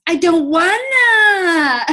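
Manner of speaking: high, loud, very slow whining wail; crazy dinosaur voice. Retold or imitated. Kid told to eat peas first, crazy dinosaur whine. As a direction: drag it out like a sobbing dinosaur toddler. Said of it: "this crazy dinosaur crazy voice".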